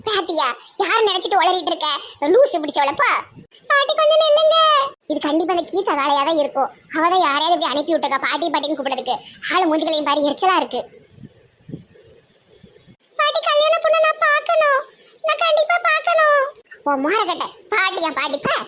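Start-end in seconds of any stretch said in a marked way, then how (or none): 3.7-4.6 much higher, fussy feminine squeal; high mock woman's voice. Man playing a girl squeals at the grandma running past, high fussy voice. 13.2-16.4 much higher, fussy feminine squeal; high mock woman's voice. Man playing a girl squeals at the grandma running past, high fussy voice.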